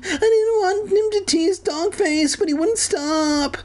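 shrill, hammed-up crybaby wail; Cartman. A narrator's reading. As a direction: blubber very high, dragging out the ending. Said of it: "Kermit sobbed like Cartman".

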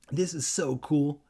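mildly excited exclamation; exclaiming. A narrator's reading. Kermit shows off his control unit, mildly exclaiming. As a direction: exclaim with a touch of delight. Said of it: "Kermit exclaimed".

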